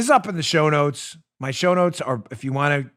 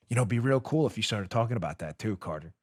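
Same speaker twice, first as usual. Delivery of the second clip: hushed, much softer, coaxing and sly; sly mock nudge; 7 semitones lower, 10 dB quieter, faster than usual. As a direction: slip it in quietly like a wink. Sly mock nudge to Carter Page.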